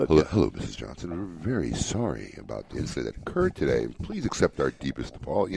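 fake-sweet customer service rep; pissed off customer service voice. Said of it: "pissed off customer service voice".

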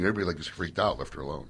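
ordinary talk, nothing marked

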